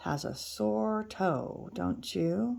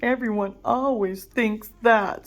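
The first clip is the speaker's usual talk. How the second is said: sadly; 2 semitones higher, 4 dB louder than usual. Acted. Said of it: "said the giant sadly".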